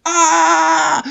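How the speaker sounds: high, sustained yell; startled scream. Real, not acted. Gamer getting hit mid-lap, high scream. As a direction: cry out as if just struck.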